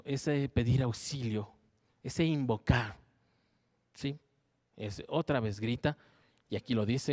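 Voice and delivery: ordinary talk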